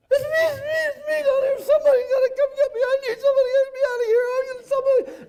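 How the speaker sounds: frantic shouted pleas, voice way up high and fast; panicked high babbling. Retold or imitated. Downed pilot shouting into his radio, begging for rescue, voice way up. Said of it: "my voice was up about three octaves".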